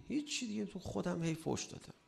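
high, soft, sheepish patient's reply; patient answering. Acted. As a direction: admit it meekly as the patient.

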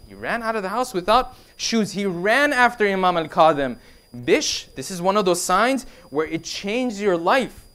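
ordinary delivery

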